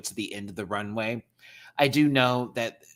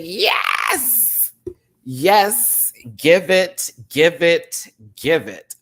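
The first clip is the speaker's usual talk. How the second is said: higher and louder, thrilled shouts of yes; excited cheering; 8 semitones higher, 11 dB louder, much slower than usual. Retold or imitated. Fan recalling cheering at the screen, excited shouts. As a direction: cheer her on like at a watch party. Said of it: "I was like".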